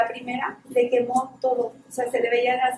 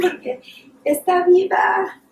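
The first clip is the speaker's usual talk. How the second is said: a laugh, then a louder, slower, higher burst of joy; 5 semitones higher, 6 dB louder, slower than usual. Real, not acted. Volunteer telling the audience the patient lives, laughing with joy. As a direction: let the joy burst out, slowly and loudly.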